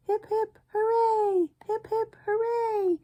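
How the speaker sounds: high, bright hip hip hooray; cheering. Acted. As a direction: cheer for the birthday. Birthday cheer on farm.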